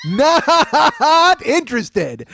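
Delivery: very slow, dragged-out flat refusal; slow, bored, deliberately stretched dismissal; drawn out. Shown as a demonstration. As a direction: stretch every syllable out slowly. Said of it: "I put three syllables and not".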